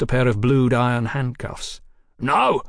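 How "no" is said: short, high yelp of protest; cornered crook's startled yelp. A narrator's reading. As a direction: yelp it, pitched up. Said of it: "Thompson yelped".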